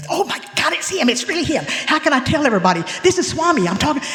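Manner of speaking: high, breathless excitement; thrilled inner voice. Retold or imitated. Speaker realising she is talking with Swami, high and thrilled. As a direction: gush like you can't believe it.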